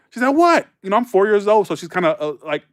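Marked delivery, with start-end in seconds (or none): 0.3-0.7 high, loud startled What?; panicking. Retold or imitated. Janet startled by the scared kid, high and loud. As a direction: imitate her jumpy alarm. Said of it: "She's panicking".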